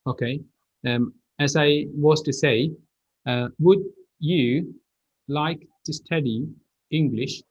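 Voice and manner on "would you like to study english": "Would you like to study English" in separate words; careful unlinked sentence. Shown as a demonstration. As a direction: pause between word groups, "Would you" unjoined. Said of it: "This is one sentence".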